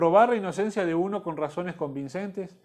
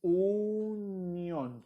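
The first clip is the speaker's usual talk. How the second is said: dictating a word; 2 semitones higher, 5 dB quieter than usual. Shown as a demonstration. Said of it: "Ponga ahí".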